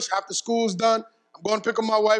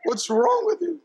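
wife scolding; 9 semitones higher than usual. Retold or imitated. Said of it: "And she goes".

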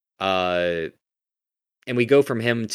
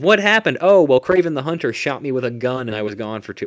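mock exposition; 5 semitones higher, 7 dB louder than usual. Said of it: "bore us with a panel of".

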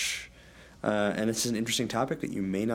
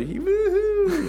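excited woohoo; 5 dB louder than usual. Acted. Celebrating new episode.

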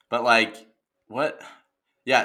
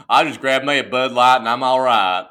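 hammed-up Southern twang; mock good old boy; 4 dB louder than usual. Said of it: "country tendencies".

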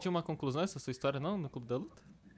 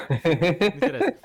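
laughing; 3 semitones higher, 9 dB louder, slower than usual. Real, not acted. Brushing off the paint teasing.

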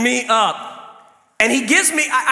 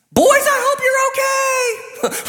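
high, slow far-off call; calling to another room; slower than usual. Retold or imitated. Dad calling to his boys in another room, high slow call. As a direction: call out to the kids down the hall. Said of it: "from the other room".

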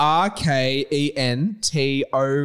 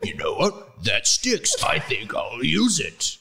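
imagined Hagrid; 2 semitones lower, slower than usual. Pretend. Hagrid decides on his new nickname, pondering in character. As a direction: mull it over slowly as the character. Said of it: "and he was like".

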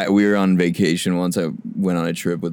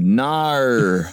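higher, drawn-out mock Aussie vowel; Australian accent; 4 semitones higher than usual. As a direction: stretch it like a broad Australian. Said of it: "Try an Australian one".

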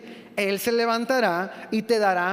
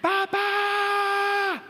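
high, drawn-out yell of papá; kid yelling; 7 semitones higher than usual. Retold or imitated. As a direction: holler papá like a child who won't stop. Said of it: "pueden gritar como una hora". (grito largo de niño llamando a papá)

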